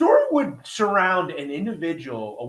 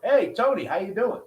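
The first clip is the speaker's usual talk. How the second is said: imitated call across a room, higher-pitched; lightly acted holler of greeting; 6 semitones higher than usual. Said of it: "yell over".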